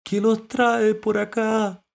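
high, slow, drawn-out question; underwater mollusc voice. A narrator's reading. Mollusc asking visitors their purpose, high and slow. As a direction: call up slowly from the depths. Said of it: "una voz desde el interior del agua".

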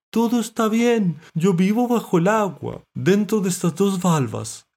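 high, loud, slow character voice; reassuring mollusc. A narrator's reading. Mollusc explaining it lives under water, high and slow. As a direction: stretch the words from under water.

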